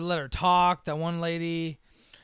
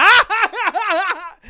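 loud, high burst of laughing; high cackling "ah" of a crowd; mock crowd laughter; 14 semitones higher, 12 dB louder than usual. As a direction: imitate an audience bursting out laughing. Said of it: "They immediately start laughing at her".

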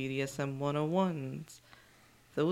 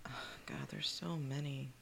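whisper; 8 dB quieter than usual. Real, not acted. Overwhelmed aside.

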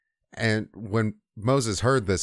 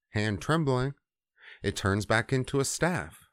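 ordinary delivery

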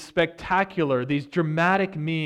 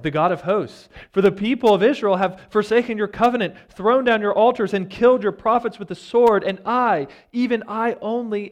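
loud, high exasperated complaint to God; loud exasperated prophet protesting; 5 semitones higher, 6 dB louder than usual. Retold or imitated. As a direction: pour out the frustration, voice raised high. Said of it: "he's exasperated".

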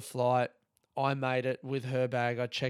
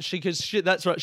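ordinary talk, nothing marked

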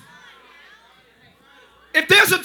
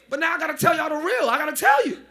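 normal talking voice

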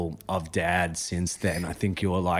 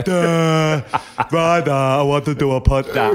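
dopey voice, higher and louder; mock your friend as a loud dope; dopey impersonation; 5 semitones higher, 4 dB louder than usual. Mimicking the co-host wanting a podcast, dopey mock voice. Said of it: "Why was I dopey?".

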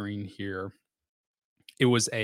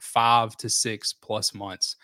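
"five to six plus months" spelled out slowly, falling at the end; slow down and weigh "five to six plus months"; slow, stressed figure; 3 dB louder, much slower than usual. Shown as a demonstration. Repeating the definition figure.

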